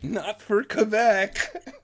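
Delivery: high, slow, laughing mock retort; mocking quip. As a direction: toss it off as a scornful joke, laughing. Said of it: "It's like".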